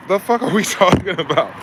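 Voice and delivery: laughing through the question; amused disbelief. Real, not acted. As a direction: crack up mid-sentence. Laughs at own rant.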